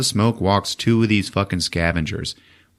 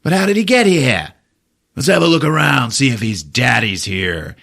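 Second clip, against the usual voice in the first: villain voice; 5 semitones higher, 4 dB louder than usual. Retold or imitated. Villain scavenger speech.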